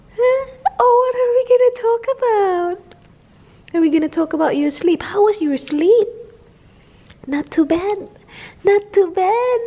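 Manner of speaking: high, delighted cooing with little laughs. Real, not acted. Mother chats to her baby.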